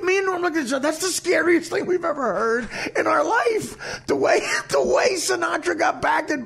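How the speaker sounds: high-pitched, worked-up storytelling; excited disbelief. Real, not acted. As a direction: tell it in astonishment, voice pushed way up high. Amazed retelling of scary prank.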